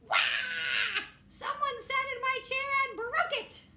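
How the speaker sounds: high, loud mock wail; hammed-up cub bawling over a broken chair; Baby Bear crying. Baby Bear wails in story.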